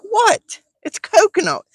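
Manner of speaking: very high squeaky incredulous cry; disbelieving, shrill-high what; dad cries out in confusion to his son; baffled disbelief. Retold or imitated. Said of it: "I'm like".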